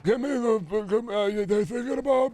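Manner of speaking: high, slow, gasping parody of a politician; Trump. Acted. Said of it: "like, modern Trump".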